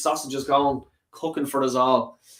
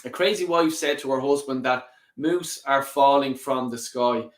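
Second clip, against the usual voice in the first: normal talking voice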